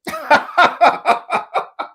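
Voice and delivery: high, loud, breathy laugh of disbelief; a real incredulous laugh; burst of laughter. Real, not acted. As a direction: laugh out at the very idea. Reacts to being called optimistic.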